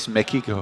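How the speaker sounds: "Mexico" as "meh-KEE-ko"; a k in place of the x in "Mexico"; matter-of-fact "Mexico" with no s sound; hard-k pronunciation. Shown as a demonstration. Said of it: "Yeah, we say Mexico".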